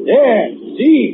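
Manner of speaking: high loud call; retold holler. [name] recalling his shout at the ladle. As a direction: shout it to make him lean. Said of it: "and I holler".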